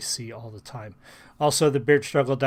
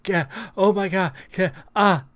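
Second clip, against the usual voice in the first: high, gushing overdone excitement; fake hype reactor; 6 semitones higher, 5 dB louder than usual. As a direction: ham up a reaction-channel gasp. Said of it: "somebody just to sit here and".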